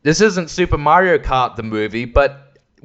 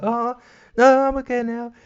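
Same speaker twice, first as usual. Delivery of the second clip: put-on kid voice; 10 semitones higher than usual. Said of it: "He's like".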